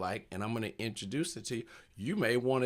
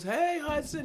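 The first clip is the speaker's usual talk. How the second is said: higher, sing-song crowd hello; crowd greeting. Retold or imitated. Audience greeting the kid by name, in a higher sing-song chorus. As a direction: mimic a whole room greeting him.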